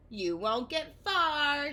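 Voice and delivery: Wiggly shouting a taunt at Oscar. Acted. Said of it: "shouted Wiggly".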